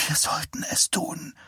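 soft unvoiced whisper. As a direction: whisper it pleadingly. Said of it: "flüsterte sie".

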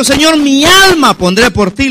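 high, loud, drawn-out pledge; fervent vow. Acted. Peter promises his soul to the Lord, high and fervent. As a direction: stretch the vow out passionately.

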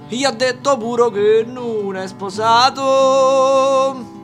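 ordinary delivery, nothing marked

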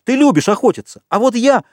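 high, heated accusation; worked-up yell, bitterly reproachful; angry shouting. A narrator's reading. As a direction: cry it out indignantly, pitch way up. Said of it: "кричал Ральф".

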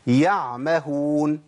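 يعمهون read slowly, with the ع clearly voiced from the throat; slow, careful recitation of يعمهون, sounding the ع; تلاوة صحيحة بطيئة مع إظهار العين; correct Quranic reading. Shown as a demonstration. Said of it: "أنا قلتها صح كده".

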